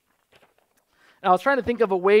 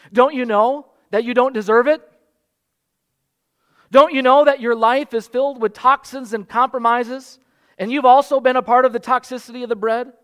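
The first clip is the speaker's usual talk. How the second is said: louder, higher, pressing rhetorical challenge; mock accusing challenge; 5 semitones higher, 8 dB louder than usual. Shown as a demonstration. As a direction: preach the accusation as a deliberate false obstacle. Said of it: "I'm putting a false obstacle in front of you".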